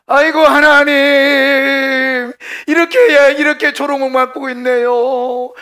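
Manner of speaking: loud, high, drawn-out wail to God; acted lament. Preacher as mocked exiles crying to God, loud wailing lament. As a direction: wail it out at length, hammed-up.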